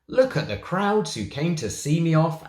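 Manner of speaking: boasting. Acted. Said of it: "Harry boasted to them".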